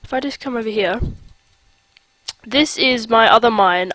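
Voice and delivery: ordinary talk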